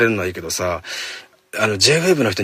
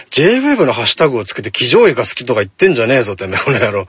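loud, rough-worded mock telling-off; mock angry rant; 3 semitones higher, 6 dB louder than usual. Host ranting at listeners over lewd hashtag posts, mock angry. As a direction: play at blowing up at them.

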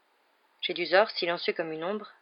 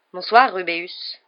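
drawn-out, louder brisk hello; brisk greeting; 8 dB louder, slower than usual. A narrator's reading. Jedusor hailing Rubéus by surprise, brisk and loud. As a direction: throw the greeting out sharply. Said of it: "lança vivement Jedusor".

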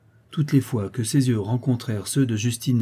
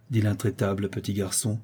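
ordinary delivery, nothing marked

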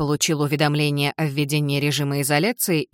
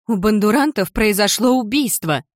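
urgent, breathless, louder outburst; breathless blurt; 5 semitones higher, 4 dB louder than usual. A narrator's reading. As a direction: blurt it out gasping for air. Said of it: "задыхаясь, выпалила Милли". (выпалила, задыхаясь)

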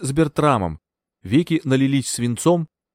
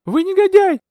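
much higher, louder furious outburst; blurted out; 6 dB louder than usual. A narrator's reading. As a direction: spit it at the villain. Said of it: "выпалила я". (гневно выпалила)

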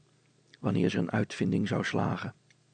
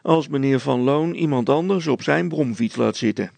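loud, raised-pitch scoffing; sneering; 4 semitones higher, 6 dB louder than usual. A narrator's reading. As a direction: keep jeering at the shopkeeper. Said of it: "smaalde de veldwachter".

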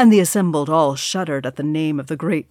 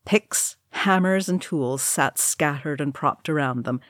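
usual speaking voice

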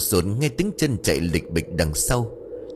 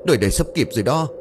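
panicked urging; 6 semitones higher, faster than usual. Acted. Urging friend while fleeing.

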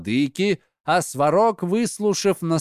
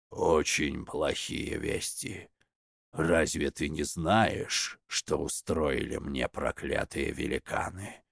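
vexed muttering; 10 semitones lower, 9 dB quieter than usual. A narrator's reading. Svarog admits bad news to Veles, muttering in vexation. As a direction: mutter it darkly under your breath, much deeper and softer. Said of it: "Сварог с досадой, опуская чудовищный молот, пробормотал".

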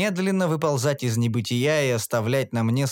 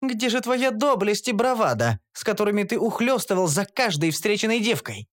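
higher voice, scornful; mocking elf; 7 semitones higher than usual. A narrator's reading. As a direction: taunt him for his lost bravado. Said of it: "процедила эльфийка". (насмешливая эльфийка)

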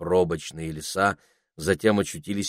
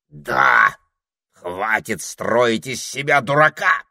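slow, loud scold; 5 semitones higher, 6 dB louder, slower than usual. A narrator's reading. Parrot scolding the ermine, slow and loud. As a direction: tell him off.

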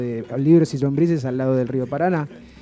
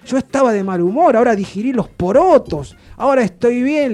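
higher, exuberant outburst; retold giddy raving, voice raised; imitated gleeful elation; 6 semitones higher, 3 dB louder than usual. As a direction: crow with delight. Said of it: "recontra cagado de la risa de nuevo dice".

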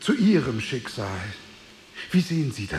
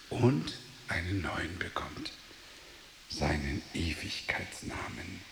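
hushed whisper; 9 semitones lower, 11 dB quieter than usual. Acted. Man confiding his plan to die to the stranger, whispering. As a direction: whisper it, much lower and softer. Man speaks of dying, new name.

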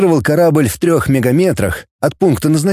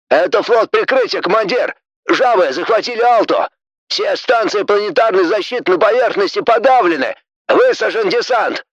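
officer report; 7 semitones higher than usual. Acted. Officer voiced high.

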